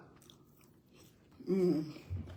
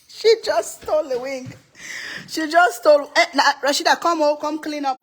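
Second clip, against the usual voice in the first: high, loud laughing scold; laughing call-out; 7 semitones higher, 6 dB louder than usual. Real, not acted. Mother calling out daughter Rashida who stole a wing, laughing. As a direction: call her back, half laughing.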